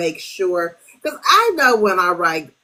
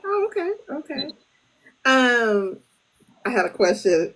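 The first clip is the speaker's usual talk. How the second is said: ordinary talk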